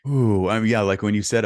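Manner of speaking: light up at the memory; real excitement bursting out; excited. Real, not acted. Recalling the good news.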